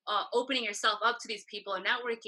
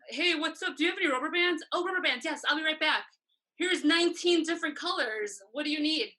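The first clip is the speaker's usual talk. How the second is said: higher-pitched, lively retold eagerness; eager assistant; 6 semitones higher, 3 dB louder than usual. Assistant offering to fetch rubber bands for the band, eagerly acted. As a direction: act out jumping to help.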